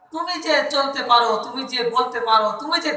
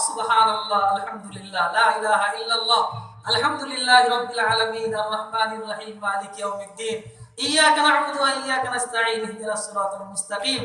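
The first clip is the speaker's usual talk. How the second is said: Quranic recitation; 3 semitones lower than usual. Real, not acted. Preacher reciting the Fatiha to the congregation, chanted. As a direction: intone the verses reverently.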